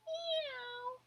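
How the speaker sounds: timid little mew; soft meow. A narrator's reading. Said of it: "said Wilbur".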